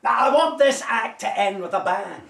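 rally the cast like a showy director; theatrical director addressing cast. Acted. Director calling his cast to order, commanding.

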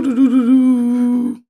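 high sing-song doo doo doo mimicking the impact; high sung doo sounds retelling a car crash; sung crash noises. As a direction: hum the crash in a high playful voice.

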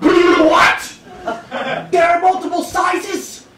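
high, loud stunned outburst; shocked disbelief. Retold or imitated. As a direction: react in total disbelief. Shocked at bra sizes.

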